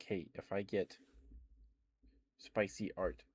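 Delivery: ordinary talk, nothing marked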